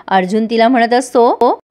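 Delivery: ordinary talk, nothing marked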